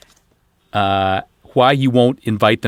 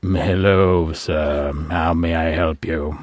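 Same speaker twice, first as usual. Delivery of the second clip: lower, formal butler; polite stiff servant; 6 semitones lower, 3 dB quieter, slower than usual. Acted. Bob plays butler.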